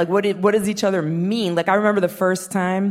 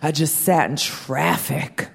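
grumpy husband; slower than usual. Retold or imitated. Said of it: "He was in the worst mood".

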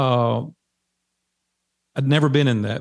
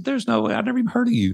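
scoffing refusal; 12 semitones higher, faster than usual. Retold or imitated. Turning down the radio ad offer.

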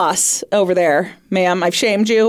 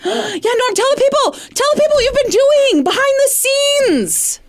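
excited. Acted. Urging Norm to announce.

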